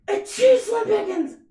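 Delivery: loud high fake sneeze shouted. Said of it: "wiping my nose".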